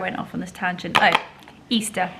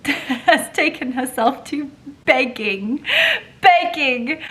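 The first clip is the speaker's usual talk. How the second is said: amused; 5 semitones higher, 6 dB louder, slower than usual. Real, not acted. Laughing about mother begging.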